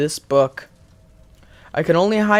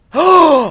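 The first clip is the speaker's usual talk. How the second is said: gasp; 11 semitones higher, 10 dB louder than usual. Real, not acted. Startled gasp at game.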